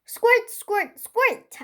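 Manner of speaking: high, loud "Squirt, squirt, squirt!"; squirting noise. Acted. Child squirting her dolls, loud high squeal. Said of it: "I said to them".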